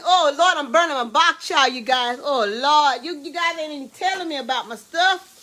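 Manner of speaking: ordinary delivery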